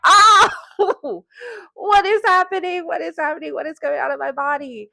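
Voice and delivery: high-pitched startled cry easing into panicked questions; retold freak-out. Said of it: "I was pretty freaking out".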